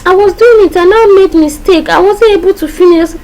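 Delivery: high-pitched mock pleading; child's excuse. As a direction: plead your case as a child caught out. Acts a child excusing a mistake.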